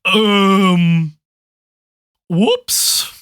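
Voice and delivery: put-on an awkward old wizard admitting a blunder; sheepish wizard. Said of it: "he looks over and he says".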